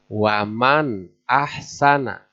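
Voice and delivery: Waman ahsana, slow with a distinct nun; clear tajwid recitation. Shown as a demonstration. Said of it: "harus jelas".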